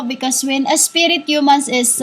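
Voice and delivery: ordinary talk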